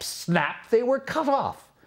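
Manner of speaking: usual speaking voice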